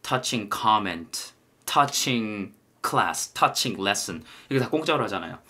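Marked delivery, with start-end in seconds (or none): 0.0-4.2 slow list, touching in focus each time; unhurried teacher reading examples; slow drill. Shown as a demonstration. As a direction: drill each phrase slowly. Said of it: "그래서 touching comedy".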